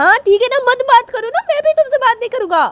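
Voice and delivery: very high, loud squabbling; acted childish tantrum; heated retort. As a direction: hurl it back indignantly. Sanju retorts in quarrel.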